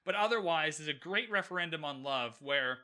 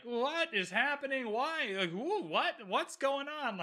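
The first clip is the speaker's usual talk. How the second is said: much higher, bewildered mock confusion; imitating clueless guests; 8 semitones higher, slower than usual. Podcaster mocking oblivious guests at dinner, high and baffled. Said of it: "Every other person is like".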